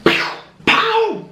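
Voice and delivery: high cartoon pew-pow; smash sound effect. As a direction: punch out the impact noises. Mimicking shoe smashing cockroach.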